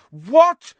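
sudden high, loud shout. A narrator's reading. Boy hearing of an imminent execution, shocked shout. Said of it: "his shout had been heard".

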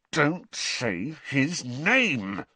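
slow tense hiss through gritted teeth. Acted. Ron warns Harry not to say the name, slow hiss. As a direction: grit your teeth and hiss it slowly. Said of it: "Ron hissed through gritted teeth".

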